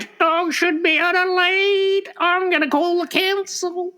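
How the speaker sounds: very high, slow, drawn-out screech; imitated old lady. Old lady from Maroubra threatens owner, high mock screech. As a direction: play an outraged old busybody in falsetto. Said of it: "And then the old lady".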